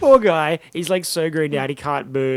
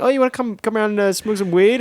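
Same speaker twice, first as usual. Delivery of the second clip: high, loud, wheedling invitation; mock tempter; 8 semitones higher, 5 dB louder than usual. Jamie coaxing Dean to come smoke again. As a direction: lure him in with fake cheer. Friend mocks Jamie luring him.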